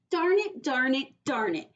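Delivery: starts high and loud then fades to plain; cross kid, losing steam each time; frustrated darn it fading. Acted. Junie repeats, fading.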